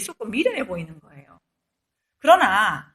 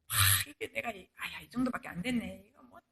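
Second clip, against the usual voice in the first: deflated muttering; 4 semitones lower, 13 dB quieter than usual. Retold or imitated. Self-reproach in despair.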